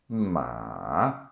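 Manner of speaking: drawn-out "Ma" dipping low, then rising; third tone, dipping. Shown as a demonstration. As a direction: overpronounce "Ma" down through a valley and up. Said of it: "then it goes up again".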